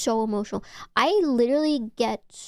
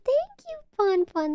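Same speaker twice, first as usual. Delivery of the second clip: squeaky thanks; 13 semitones higher than usual. Real, not acted. Thanking a chatter warmly.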